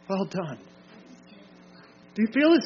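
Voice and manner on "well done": hushed commendation. Acted. Preacher voices approval to those overcoming, hushed commendation. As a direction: repeat the praise gently, much quieter. Praise repeated more softly.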